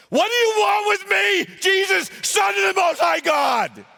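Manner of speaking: high, loud demonic shriek; demon shouting. Acted. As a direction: scream it at Jesus like a possessed man. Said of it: "You probably never heard it read like that".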